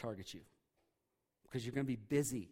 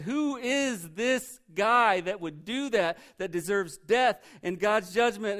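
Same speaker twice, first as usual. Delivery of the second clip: slow, louder, higher outraged demand for justice; indignant king; 6 semitones higher, 10 dB louder, slower than usual. Acted. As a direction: rage at the villain, not knowing it's you. David outraged at the rich man.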